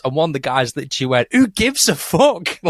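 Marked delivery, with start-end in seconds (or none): 1.3-2.6 high, drawn-out mock exasperation; blunt dismissal. Bandmate dismissing the two-hour stage caveat, exasperated. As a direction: imitate a mate scoffing, slow and high.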